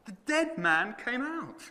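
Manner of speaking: high, bright thrill of delight; excited. Acted. Preacher thrilled at the dead man walking out, high. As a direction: burst out with glee.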